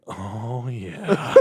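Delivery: a quiet, dazed mock drunk; mumbled yes. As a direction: mumble it like a half-out-of-it guy. Voicing the Santa guy, mumbled.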